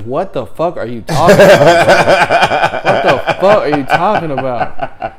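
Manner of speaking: loud, high, laughing indignation; mock outrage. Angry civilian at the wrong door, mock outrage. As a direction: act out outrage while cracking up.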